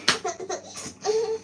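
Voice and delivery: high, tearful laugh; a laugh that turns to crying; laugh breaking into sob. Real, not acted.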